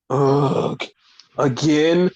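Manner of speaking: low, fed-up mock groan; weary groan. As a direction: imitate someone sick of it happening. Imitates colleagues fed up with tweets.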